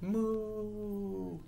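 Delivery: soft, high, long moo. Acted. Reader demonstrates cow moos for the book, high.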